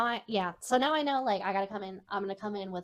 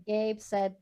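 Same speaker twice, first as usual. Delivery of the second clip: usual speaking voice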